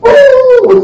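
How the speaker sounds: loud, very high beam noise; high whooshing "ooh". Acted. Angel's light shooting from his hands, loud high whoosh.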